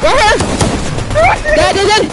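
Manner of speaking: high, loud combat callout; excited shout. Real, not acted. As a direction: shout the enemy's position urgently. Calling out enemies mid-fight.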